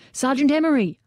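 high, loud call across the roof. A narrator's reading. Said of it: "then louder".

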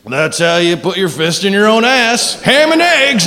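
high, punchy catchphrase on ham and eggs; hack comedian catchphrase. Retold or imitated. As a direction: sell the catchphrase like a hack comic. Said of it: "where it's just like".

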